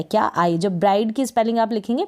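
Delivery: ordinary talk, nothing marked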